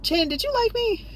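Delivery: high, soft, coy wondering voice; coy question. Retold or imitated. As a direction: ask it sweetly, teasing in hindsight. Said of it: "thinking about it retrospectively, like".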